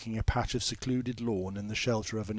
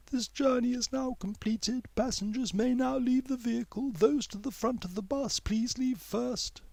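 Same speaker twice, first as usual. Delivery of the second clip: bus announcement; 13 semitones higher than usual. A narrator's reading. Bus announcing to passengers, high automated voice. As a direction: read it like a robotic transport announcer.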